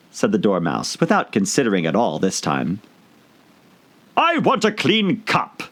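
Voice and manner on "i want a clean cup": high, slow demanding hatter; interrupting Hatter. A narrator's reading. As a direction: cut in as the Hatter. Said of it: "interrupted the Hatter".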